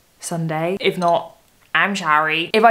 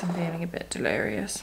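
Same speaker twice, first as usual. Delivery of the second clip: much softer, almost under breath; muttered repeat; 9 dB quieter than usual. Real, not acted. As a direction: say it again to yourself, quietly.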